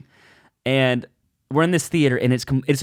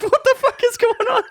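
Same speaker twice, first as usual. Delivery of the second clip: panicked disbelief. Retold or imitated. Comic losing the room, high panicked cry. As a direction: squeal it in onstage panic. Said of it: "it's like".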